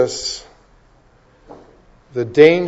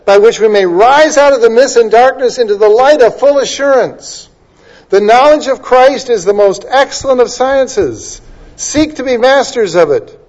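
soaring preaching; 6 semitones higher, 4 dB louder than usual. Acted. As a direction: lift the congregation toward assurance. Spurgeon quote preached.

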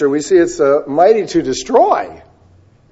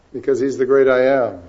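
usual speaking voice

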